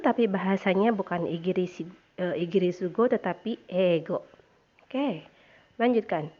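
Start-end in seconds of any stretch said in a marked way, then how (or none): none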